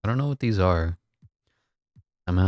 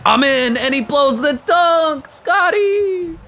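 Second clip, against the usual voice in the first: very high, loud wail; high-pitched yell, stretched out in dismay; anguished excitement; 5 dB louder, much slower than usual. Real, not acted. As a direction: cry out in disbelief at a blown chance. Player blows a dunk in game.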